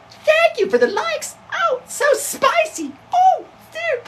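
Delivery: very high, slow squeaky streamer voice; streamer parody. Acted. Parodying a livestream girl thanking viewers for likes, falsetto mockery. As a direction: gush thanks in a drawn-out falsetto. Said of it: "I'm gonna do a parody on it".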